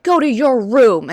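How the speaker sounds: slow, loud, high command; mock mother's command; stern mom order. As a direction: order him to his room like a stern mother. Mom scolding son, acted.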